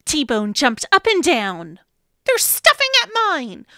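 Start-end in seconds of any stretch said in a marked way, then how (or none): 2.2-3.7 excited. Acted. Eager friend offering help.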